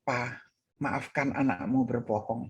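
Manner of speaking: low, soft retold confession; hushed apology. Pastor apologises to his father's photo, hushed.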